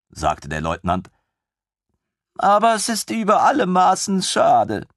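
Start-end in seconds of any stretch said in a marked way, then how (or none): 2.4-4.9 put-on high, bright-eyed young officer, gushing; a thrilled lieutenant who can't believe his luck; eager lieutenant voice. Lieutenant gushing over the queen's griffin, high and eager.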